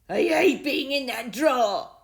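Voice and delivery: high, loud, slow grumble; sulky dog complaining; growled. A narrator's reading. Dog complaining to Stanley, mild growl. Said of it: "he growled".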